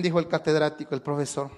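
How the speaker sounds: ordinary talk, nothing marked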